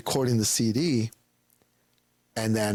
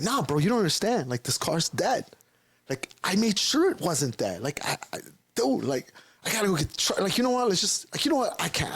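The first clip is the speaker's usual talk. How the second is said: higher, exasperated pleading; fed up with the dead car, voice climbing higher; frustrated; 7 semitones higher than usual. Retold or imitated. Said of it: "I'm getting really frustrated now".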